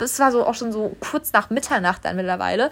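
normal talking voice

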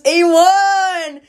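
loud, high call across the room. Real, not acted. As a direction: call his name out loud. Shouting out to a teammate.

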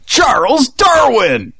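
big slow infomercial announcer yell. Pretend. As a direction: sell the name like a TV pitchman. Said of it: "that sounded like an infomercial guy".

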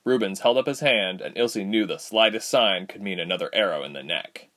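plain reading voice

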